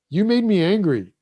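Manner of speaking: mock upset. Upset partner blaming the other, acting out an angry reaction. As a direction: act out an upset partner's loud accusation. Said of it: "and you get upset".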